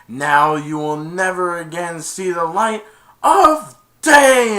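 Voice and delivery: slow loud menacing cave voice, rising at the end; doom them like an angry giant cave; loud drawn-out threatening curse; booming curse. Retold or imitated.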